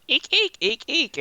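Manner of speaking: high, squeaky eek cries; goblin squeal. Acted. Goblin squealing at the party. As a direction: squeal like a terrified little goblin. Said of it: "Walder goes".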